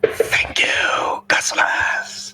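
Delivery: whispering. Pretend. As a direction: whisper the thanks like a secret. Echoing the thanks in whisper.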